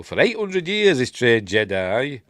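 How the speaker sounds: loud, high start, slowing down; emphatic drawn-out imitation of an old master; mock Yoda. Yoda telling of his years training Jedi, loud imitation. Quoting Yoda's line.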